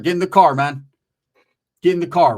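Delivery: loud, forceful get in the car; repeated yelling to get in the car; shouted order. Retold or imitated. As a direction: bark it at her, insistent.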